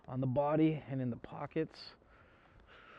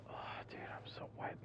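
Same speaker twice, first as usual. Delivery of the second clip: whisper; 16 dB quieter than usual. Real, not acted. Whispering inside the store.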